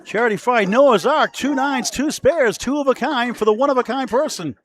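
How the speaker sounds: high, punched-out list; loud, lively commentator flourish; excited play-by-play. Real, not acted. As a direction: hype the bowler in short bursts. Co-commentator celebrates the shot.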